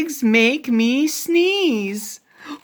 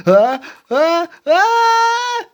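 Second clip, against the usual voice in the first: sneeze build-up; 8 semitones higher, 5 dB louder than usual. Acted. Gerald about to sneeze.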